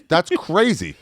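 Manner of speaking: squeaky high disbelief; shocked guide. Retold or imitated. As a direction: go way up, aghast.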